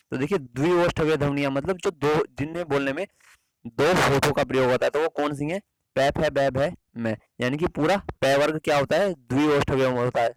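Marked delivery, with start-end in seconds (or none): 6.0-7.2 "पै, फै, बै, भै, मै" each sound said slowly and separately; bilabial sounds demo. Shown as a demonstration. Teacher to students, sounding out "पै फै बै भै मै" carefully. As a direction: sound out "पै फै बै भै मै" one by one, lips pressed. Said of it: "आप इसे बोल कर भी देखिए".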